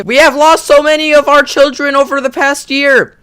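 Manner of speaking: loud high mournful cry. Shown as a demonstration. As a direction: wail it in grief. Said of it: "retake, dramatic".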